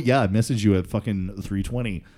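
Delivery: usual speaking voice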